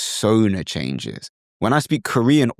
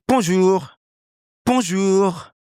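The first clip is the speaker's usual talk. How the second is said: high-pitched French bonjour; native French accent; 9 semitones higher, slower than usual. Shown as a demonstration. Teacher adopting Clément's character, native French accent. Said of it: "Can you hear the difference?".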